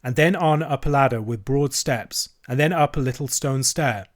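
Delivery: ordinary delivery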